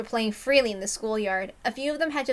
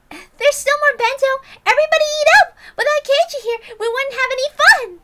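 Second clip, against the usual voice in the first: rally everyone to eat; cheerful call; 13 dB louder than usual. Acted. Rena urging everyone to eat bento, very high and loud.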